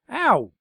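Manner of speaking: sharp loud high ow; loud pained ow; desk's yelp. Acted. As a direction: yelp as if struck. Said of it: "said the desk".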